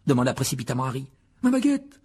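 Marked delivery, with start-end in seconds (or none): 1.4-2.0 high, quavering dismay; shaken, high-pitched reply; trembling voice. A narrator's reading. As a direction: answer with a trembling voice. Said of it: "répondit Ron d'une voix tremblante".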